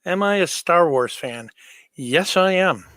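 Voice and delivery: usual speaking voice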